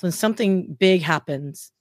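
normal talking voice

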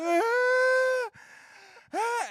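high, loud wailing ah; mock wailing cry. Boy caught stealing by his dad, loud mock wail. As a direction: wail like a caught kid bursting into tears. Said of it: "I just started crying".

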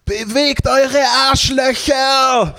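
long, drawn-out high-pitched yell; imitated crowd-hyping shout. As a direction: scream it slowly like at twenty thousand people. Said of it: "er schreit einfach so".

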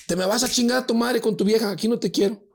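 high, loud mock tirade; scolding sister. Sister throws brother and girlfriend out, scolding. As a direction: imitate an angry sister sending him packing.

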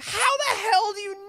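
high, shouted disbelief; incredulous shout. Retold or imitated. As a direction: yell it in total disbelief. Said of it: "I'm like".

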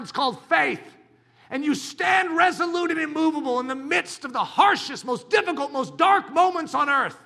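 loud, high, rousing sermon; fiery preaching. Acted. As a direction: preach it full force. Preaching at full force.

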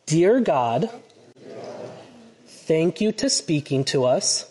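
slow, phrase-by-phrase prayer for the congregation to echo; leading prayer. Real, not acted. Pastor leads the congregation in prayer, line by line. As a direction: pause after each phrase so they can repeat. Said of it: "repeat after me".

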